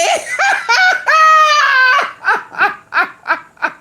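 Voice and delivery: high, loud, squealing cackle that breaks into a cry; howling laugh. Real, not acted. Streamer cracking up at a chat joke, high squealing howl. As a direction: lose it completely, wheezing laugh.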